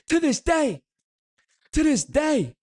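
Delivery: high, loud cry of tribute; hammed-up shout. Streamer honouring a legend of the channel, hammed-up high shout. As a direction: belt it out far above your talking pitch.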